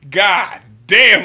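loud, high exclaiming. Retold or imitated. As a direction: shout it out in awe. Coworker bursting out the door.

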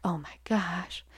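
slow, hushed gasp of gossip; imitated classmates' whisper; whispered shock. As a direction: whisper it like a classmate who just noticed. Classmates murmuring about her crying.

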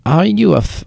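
put-on French accent. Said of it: "in a very French accent".

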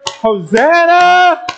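very high, drawn-out jubilant shout. Shown as a demonstration. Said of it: "say it a little bit louder".

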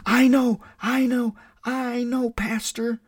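put-on high, bright child's voice, pressing to be picked; a little girl bursting to answer; eager little girl. Little girl begging the pastor to call on her, high and eager. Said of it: "a little girl raised her hand and said".

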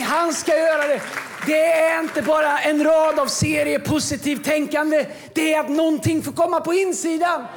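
loud, high-pitched preaching, nearly shouting; full-force preaching. Acted. Pastor to the congregation about the Spirit, preaching at full force. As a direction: drive it home like a revival preacher.

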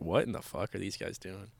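quiet imagined bystander muttering; muttered onlooker. Pretend. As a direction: murmur it like a puzzled passerby.

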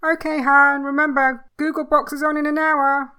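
loud, high call across the house; loud, cheerful calling out. Acted. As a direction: call out to him from the other room. Wife calls out from another room.

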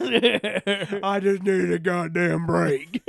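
drawn-out mock hard-man line; slow tough guy voice, laughing. Answering in the Scum voice.

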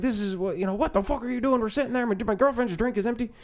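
high, quick, indignant mock Irish rant; Irish accent yelling. Hungry customer berating the waitress, yelling in an Irish accent. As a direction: scold in a high-pitched put-on Irish accent. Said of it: "yelling at an, uh, at an, uh, Filipino girl in an Irish accent".

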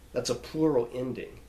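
usual speaking voice

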